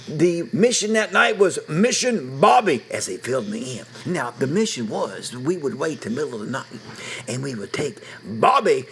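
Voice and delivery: ordinary talk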